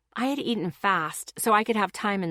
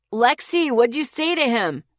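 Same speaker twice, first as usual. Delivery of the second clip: much higher and louder call; shouted; 5 semitones higher, 6 dB louder than usual. A narrator's reading. Friend shouting to her from the background, high and loud. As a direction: yell it across the room. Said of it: "Jake shouted from the background".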